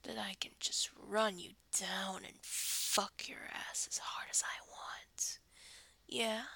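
ordinary talk

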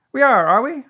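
high, loud animal character voice; brisk storybook busybody, pitched right up; Rabbit. A narrator's reading. Rabbit answering Pooh's greeting, high character voice.

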